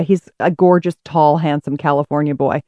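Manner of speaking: ordinary delivery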